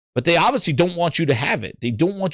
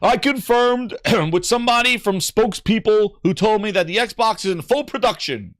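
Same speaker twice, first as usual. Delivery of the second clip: much higher, hammed-up protest; high mock insistence; 7 semitones higher than usual. Mimicking co-host denying Xbox cuts, high hammed-up protest. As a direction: squeal it like a defensive fan. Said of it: "he'll be like".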